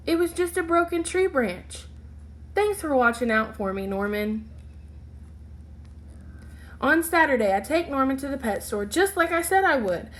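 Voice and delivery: put-on bright, lively reading to children; storybook read-aloud. A narrator's reading. Teacher reading a picture book to her class, lively. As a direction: read it like story time for little kids.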